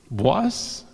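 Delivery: put-on rising, puzzled echo of an accented word; mock-baffled teenager's question; amused disbelief. Teenage student echoing the teacher's odd word, amused disbelief. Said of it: "We kinda laugh at him because his accent".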